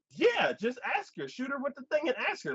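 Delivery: high, fast chirpy woman voice; lightly hammed-up ditzy woman; mock airy woman. Voicing the woman character.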